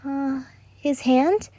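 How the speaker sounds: put-on high, slow, hesitant child voice; a small girl guessing timidly; unsure little girl.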